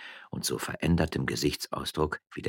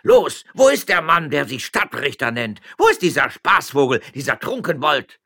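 high, loud mob cries; wild shouting; 9 semitones higher, 9 dB louder than usual. Acted. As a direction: storm out yelling. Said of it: "in wildem Geschrei". (Diener stürmen mit wildem Geschrei heraus)

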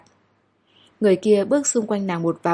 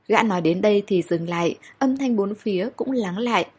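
ordinary delivery, nothing marked

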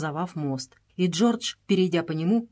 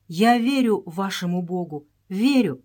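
ordinary delivery

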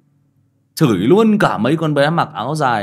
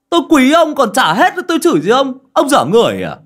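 high, loud indignant protest; indignant denial; 13 semitones higher, 9 dB louder than usual. Acted. Drinker denying he cursed his friend, indignant. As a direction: protest loudly, pitched right up, as if wrongly accused.

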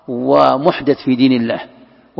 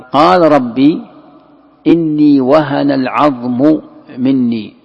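slow solemn recitation; 5 dB louder, much slower than usual. Shown as a demonstration. Reciting Zakariya's prayer verse.

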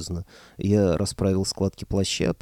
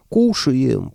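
loud, raised booming voice; booming dragon head; 5 semitones higher, 6 dB louder than usual. A narrator's reading. Dragon head answering its master, booming. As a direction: boom it out as a big beast. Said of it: "пробасила явно правая голова".

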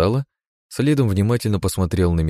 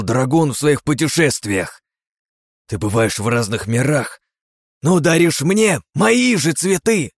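slow, loud, high furious yelling; furious rant; 8 semitones higher, 4 dB louder than usual. Acted. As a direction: bite off every word in rage. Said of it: "чеканя каждое слово".

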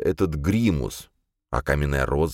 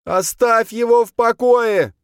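high, loud, drawn-out scream; frantic high-pitched yell; 7 dB louder, slower than usual. A narrator's reading. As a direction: bellow it high to protect him. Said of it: "заорала Долорес".

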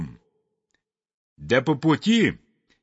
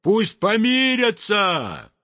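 high, slow chanting call; drawn-out high cry; crowd shout; 8 semitones higher than usual. A narrator's reading. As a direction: call out as a crowd demanding peace. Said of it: "крикнули все хором".

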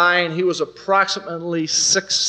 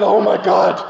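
aghast managers; 3 semitones higher, 4 dB louder, slower than usual. Retold or imitated. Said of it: "managers are sitting there going".

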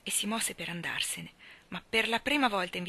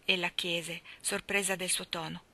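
usual speaking voice

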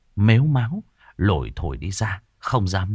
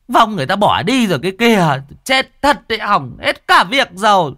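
exasperated complaint; 7 semitones higher, 6 dB louder than usual. A narrator's reading. Cô An upset spirit left.

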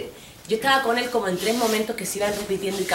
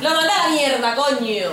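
high, loud boast; boasting about the breakup; proud shout; 7 semitones higher, 6 dB louder than usual. Retold or imitated. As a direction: crow it out. Proud exclamation.

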